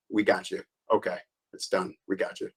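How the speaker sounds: soft, final we got you; quiet officer closing the arrest; quiet gotcha. Retold or imitated. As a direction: calmly tell the suspect it's over. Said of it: "also an okay to me".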